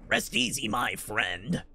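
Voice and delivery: put-on voice dropping low at the end; imitating a game character. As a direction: mimic a character's solemn line, sinking deep on the last words. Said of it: "I wish I could sound like that".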